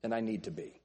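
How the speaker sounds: ordinary talk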